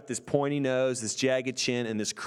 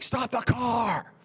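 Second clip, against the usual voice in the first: high, drawn-out imitated holler; adamant mock yell; 9 semitones higher, much slower than usual. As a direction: holler the order, stretching it out. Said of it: "he was so adamant about stopping".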